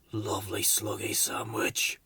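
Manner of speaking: soft whispered mumble; whispered sleepy sleep-talk. Acted. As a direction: murmur dreamily in your sleep. Sleeping creature talks in sleep.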